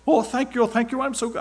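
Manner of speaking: put-on a weak try at a Russian girl's thanks; mock Russian accent. Russian girl thanking him, in a mock Russian accent. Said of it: "I can't do a Russian accent".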